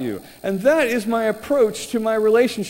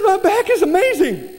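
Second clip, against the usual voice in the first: high, bubbly, overjoyed voice; gushing waitress; 11 semitones higher, 3 dB louder than usual. Retold or imitated. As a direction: gush about the healed back. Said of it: "She says".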